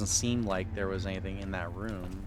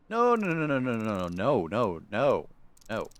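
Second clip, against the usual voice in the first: panicked refusal; 2 semitones higher, 6 dB louder, slower than usual. Acted. Prisoner refusing to leave the cage, frantic shouting. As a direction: panic at the idea.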